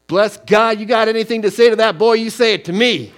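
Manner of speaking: loud, high challenge; dad shouting down the men; protective father. Retold or imitated. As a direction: stand up for your boy.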